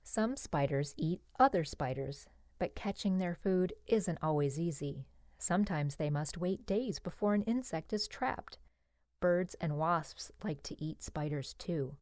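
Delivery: ordinary delivery, nothing marked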